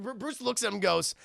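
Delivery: ordinary talk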